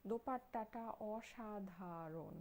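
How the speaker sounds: অসাধারণ stretched syllable by syllable; slow, savoured emphasis on অসাধারণ; drawn-out praise. Real, not acted. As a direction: linger slowly on every syllable of অসাধারণ. Gushing over the dupatta to buyers.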